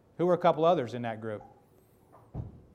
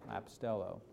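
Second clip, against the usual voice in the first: "apostello" dropped to a soft echo, said as a-po-STEL-lo with a falling end; softer echo; 5 semitones lower, 12 dB quieter than usual. Shown as a demonstration. The pastor echoes the Greek word apostello to the congregation, quietly. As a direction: let "apostello" fade out softly, stressing STEL. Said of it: "repeats the word apostello".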